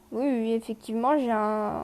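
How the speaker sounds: normal talking voice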